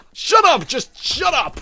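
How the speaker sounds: loud, high yell at the suspect; detective screaming at a suspect to shut up; furious shout. Acted.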